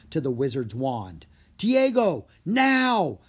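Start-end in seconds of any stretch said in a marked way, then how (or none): 1.6-3.2 loud, high urgent order; yelled command. Acted. Drake tells Diego to cast the bubble, loud shout. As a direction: shout the signal. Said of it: "Drake yelled".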